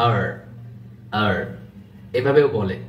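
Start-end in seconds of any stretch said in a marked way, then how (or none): 0.1-1.3 second way of saying R; other R. Shown as a demonstration. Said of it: "এভাবে বলতে পারেন".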